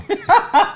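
risita muy aguda; risa aguda. Real, not acted. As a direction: se le escapa una risa aguda. Se ríe del dueño. (risa aguda de incredulidad)

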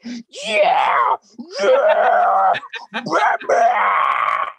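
high loud yelling with laughter; excited cheering. Retold or imitated. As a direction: shout it like fans celebrating. Retold cheering at trailer.

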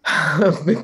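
sharp audible intake of breath; demonstrative gasp; awed gasp. Shown as a demonstration. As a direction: gasp as if taken aback in admiration. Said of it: "go like this".

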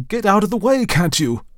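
frantic high shout; loud, high-pitched urgent yell. A narrator's reading. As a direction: shout to clear the path.

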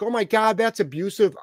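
a prissy scandalized imitation; mocking critics. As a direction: parody the outraged crowd. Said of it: "all these people are like".

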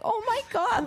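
touched and surprised by the cards; overwhelmed gasp. Retold or imitated. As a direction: gasp it out in a high voice. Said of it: "I was like".

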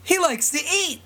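high, bright exclaim; exclamation point. Retold or imitated. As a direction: read it with a big exclamation mark. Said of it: "Exclamation point".

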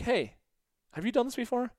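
high, bright friendly question; high friendly call. Retold or imitated. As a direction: greet a kid cheerfully. Calling to little girl.

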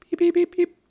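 high mimicked beeping; scanner beeps. At the ticket gate, high imitated scanner beeps.

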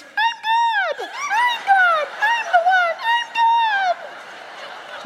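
very high tiny squeaking I'm God; squeaky mouse voice. Retold or imitated. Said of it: "not like a mouse standing on the beach".

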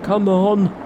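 high, loud challenge; crying out. A narrator's reading. Pig disciple luring the monster ashore, crying out. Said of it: "cried Pigsy".

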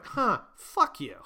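slow, offhand fuck you; unbothered brush-off; blunt dismissal. Retold or imitated. Scene: Target answers the activist, slow blunt brush-off. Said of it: "what if they just went".

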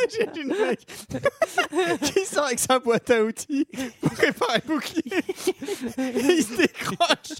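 giggling through the words, high and breathless; nervous laugh. Real, not acted. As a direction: laugh nervously while telling it. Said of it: "c'est un rire nerveux".

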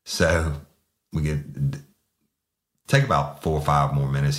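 ordinary talk

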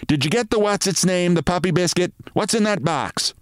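wife scolding her dreamy husband; nagging wife. Acted. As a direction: tell him off impatiently. Wife questions Mitty.

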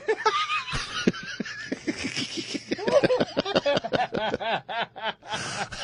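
long, very high laughing fit; real high wheezy laughter; wheezing laughter. Real, not acted. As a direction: laugh helplessly until wheezing. Cracks up at own joke.

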